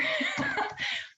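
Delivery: a quick squeaky laugh; sudden high-pitched self-amused laugh. Real, not acted. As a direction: burst into a high giggle at yourself. Laughs at her own tip remark.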